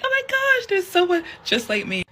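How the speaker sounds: excited high-pitched delight; excited squeal. Retold or imitated. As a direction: squeal like you've spotted a friend. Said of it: "you would get so excited".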